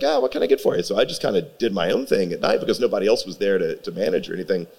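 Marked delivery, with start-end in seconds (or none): 0.0-0.8 high friendly counter voice; high cheerful server voice; chipper service voice. Retold or imitated. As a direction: take the order brightly. Serving the customer himself.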